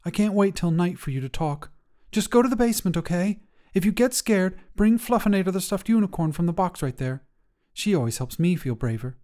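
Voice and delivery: put-on a young girl begging her closet monster to hide; worried kid voice, pushing hard to be believed; earnest little girl. Jacayla urges monster to basement.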